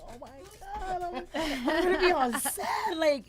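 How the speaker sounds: high, loud thrilled squeal. Retold or imitated. Thrilled to be on set.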